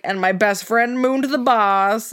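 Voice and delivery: put-on very slow, loud drunk gossip; tipsy mom. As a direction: drag it out like a giddy drunk. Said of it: "she's like".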